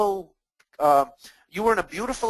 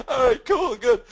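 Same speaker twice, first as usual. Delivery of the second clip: laughing; 8 semitones higher, 5 dB louder than usual. Real, not acted. Laughing at the joke.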